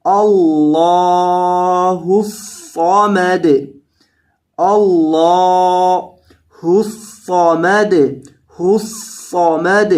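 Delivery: الصمد with a thick, heavy sad and bounced dal. Shown as a demonstration. Teacher showing the heavy sad. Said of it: "اسے موٹا کیا جائے گا".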